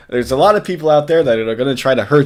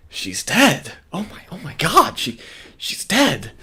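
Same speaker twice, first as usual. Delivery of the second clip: mock concern; 5 semitones higher, 3 dB quieter, slower than usual. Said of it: "all concerned".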